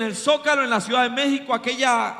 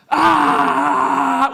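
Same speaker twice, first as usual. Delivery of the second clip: long, loud imitated scream; mock scream; 2 semitones higher, 8 dB louder than usual. Preacher acts out the fortune-teller's screaming fit, long loud scream. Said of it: "la mujer empieza a gritar".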